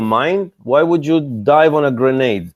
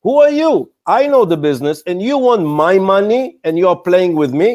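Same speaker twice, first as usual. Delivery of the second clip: higher, pointed exasperation at a presenter; angry investor rant; 5 semitones higher than usual. Retold or imitated. Investor scolding an evasive startup founder, indignant and higher. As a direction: scold the startup like you're fed up. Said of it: "I'm getting angry just by telling it".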